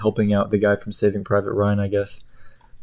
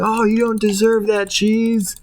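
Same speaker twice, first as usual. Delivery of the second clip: high, strained protest; pained grocery; 12 semitones higher than usual. Retold or imitated. The cheese is grated, high pained protest. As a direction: cry out at the grating. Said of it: "he's like".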